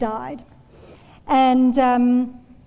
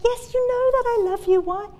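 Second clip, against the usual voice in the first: irritated, much higher-pitched retort; Peter; 10 semitones higher than usual. Acted. Peter answers Jesus asking yet again, irritated. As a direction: push the pitch right up, exasperated at being asked. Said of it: "he actually gets really irritated with Jesus".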